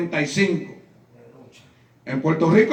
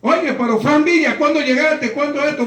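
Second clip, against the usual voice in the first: excited greeting; 7 semitones higher than usual. Retold or imitated. Friends greet him in surprise.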